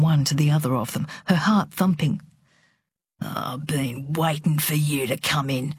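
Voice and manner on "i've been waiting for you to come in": low-key, restrained threat; quiet menace. A narrator's reading. Father confronting his daughter as she comes in, quiet menace. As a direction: say it quietly, holding the anger back.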